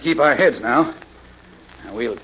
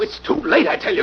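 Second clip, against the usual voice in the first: rising panic, voice jumps high; a frightened crook pleading to flee; panicked; 5 semitones higher than usual. Acted. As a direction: push it up high with fear. Cornered by the unseen Avenger.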